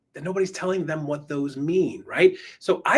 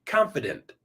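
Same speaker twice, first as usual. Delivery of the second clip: "Confident" with a falling end; dropped down; 3 semitones lower than usual. Shown as a demonstration. Coach drilling confident endings, "Confident" going down. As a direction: say "Confident" as a settled statement, falling. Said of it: "Drop it down".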